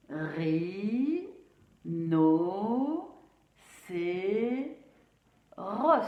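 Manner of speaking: "Ri, no, c'est, ros" in evenly spaced, drawn-out syllables. Shown as a demonstration. Teacher repeating the syllables for pupils, evenly separated. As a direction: lift "Ri, no, c'est" in turn, short pauses, end on "ros". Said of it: "pour bien redire les syllabes".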